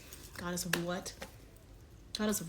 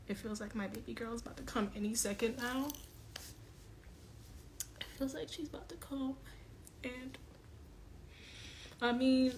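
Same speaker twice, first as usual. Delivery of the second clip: holding back tears; 5 semitones higher, 3 dB quieter than usual. Real, not acted. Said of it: "I'm trying not to cry".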